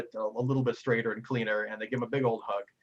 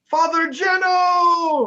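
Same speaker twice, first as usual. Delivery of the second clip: drawn-out, much higher and louder cheer; joyful crowd shout; 10 dB louder, much slower than usual. Acted. Bar patrons shouting to greet the priest, overjoyed. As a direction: hail him like a beloved hero.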